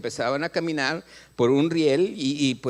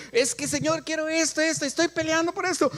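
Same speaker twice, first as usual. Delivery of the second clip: much higher whiny pleading; mock whining believer; 11 semitones higher than usual. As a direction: whine to God for what you want. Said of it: "Muchas veces nosotros". (creyente quejumbroso, voz mucho más aguda)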